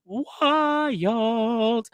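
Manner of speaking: high, very slow stretched wild; drawn out wild. Real, not acted. Telling viewers about the episode, stretched high.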